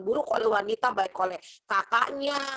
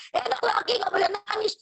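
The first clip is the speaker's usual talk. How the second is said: much higher put-on scolding voice; scolding adult; 9 semitones higher than usual. Adult chiding a boy who fell, high put-on voice. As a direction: chide the fallen boy. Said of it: "Terus kita bilang".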